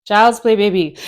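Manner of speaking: loud, swaggering boast; proud dancer showing off; cocky brag. Pretend. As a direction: brush it off like it's nothing. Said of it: "Or maybe you're like".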